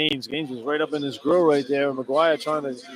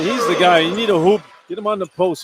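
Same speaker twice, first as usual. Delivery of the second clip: louder and higher, pressing the point; emphatic analyst; 4 semitones higher, 7 dB louder than usual. Real, not acted. Color man insisting they feed the big man, louder. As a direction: insist like the answer is obvious. Analyst urging post play.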